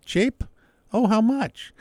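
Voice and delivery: high-pitched gushing tourist; gullible excited tourist. Retold or imitated. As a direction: gush like a gullible tourist falling for it.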